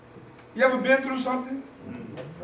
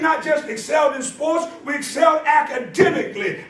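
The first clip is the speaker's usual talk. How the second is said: raised preaching voice, driving each phrase; 4 semitones higher, 3 dB louder than usual. Acted. Preacher rallying the congregation about school brothers, preaching.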